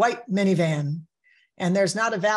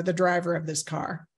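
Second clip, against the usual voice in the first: ordinary talk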